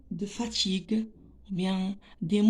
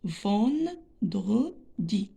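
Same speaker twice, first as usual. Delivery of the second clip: vendredi very slowly, ven-dre-di; teacher sounding out vendredi; split into syllables; much slower than usual. Shown as a demonstration. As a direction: break vendredi into three pieces with gaps. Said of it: "trois syllabes".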